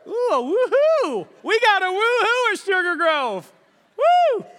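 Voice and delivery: high, loud delighted cheer; excited whoop. Real, not acted. Preacher cheering his congregation's woohoo, high and loud. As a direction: celebrate the crowd's woohoo.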